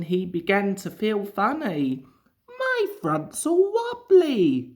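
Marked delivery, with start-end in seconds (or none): 2.5-4.7 much higher, slow, whiny engine's complaint; a worried little engine moaning; complaining. A narrator's reading. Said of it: "he complained".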